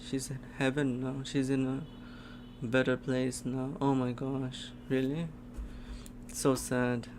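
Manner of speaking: soft, subdued sad reaction; saddened. Real, not acted. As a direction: let the sad news sink in softly. Reacts sadly to mother's death.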